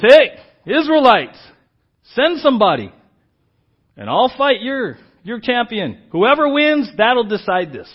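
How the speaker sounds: huge booming giant's challenge, slow and loud; Goliath taunting. Retold or imitated. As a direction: bellow it like a giant calling out the army. Preacher voices Goliath's challenge.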